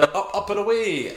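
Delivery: high mock superhero call; mock distant call. Podcaster imitating a cry heard in the distance, high mock call. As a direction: call it out lightly as if flying off. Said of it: "in the distance we hear".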